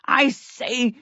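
high-pitched protesting call, drawn out; a king's slow, put-out call. A narrator's reading. King protesting as he chases the fleeing prince, calling out. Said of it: "the king called out".

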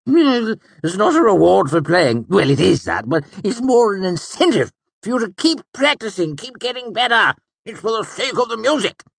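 high excited nobleman; bright, laughing older count; Count Threep voice. A narrator's reading.

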